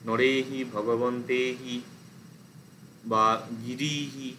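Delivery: হি stressed and lengthened in নরে হি, ভগবন্তে হি, গিরি হি; stressed long ending. Shown as a demonstration. Teacher showing students the stressed long ending, slow. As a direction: lean on a long হি at each ending. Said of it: "একটা stress পড়ছে plus long হচ্ছে ভাওয়েলটা".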